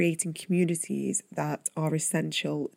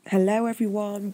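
normal talking voice

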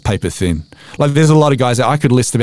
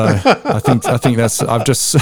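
usual speaking voice

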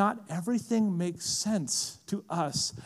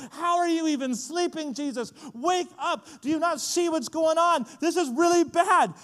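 high, urgent yelling to wake someone; acted panic, building louder to the end; panicked disciples; 9 semitones higher, 3 dB louder than usual. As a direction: plead in alarm, pitched way up. Acts panicked disciples waking Jesus.

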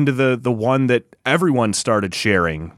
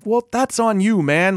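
exasperated retort; 7 semitones higher than usual. Retold or imitated. Acting out player's retort to reporter.

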